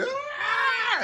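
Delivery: very high frenzied shriek; crazed scream. Acted. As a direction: shriek like a crowd gone crazy. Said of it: "they go even more crazy".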